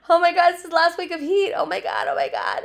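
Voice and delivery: mock-dying of heat, hammed up; dramatic heat complaint. Retelling October heat moans, a hammed-up high whine. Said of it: "remember back in October when we're like".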